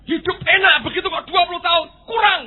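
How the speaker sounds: high, loud complaining shout; loud protest. Acted. The human loudly protests to God about too few years.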